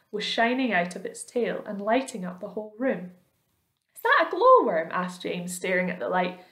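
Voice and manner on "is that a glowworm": much higher and louder, wide-eyed; amazed question. A narrator's reading. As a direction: ask in wonder. James amazed at the light.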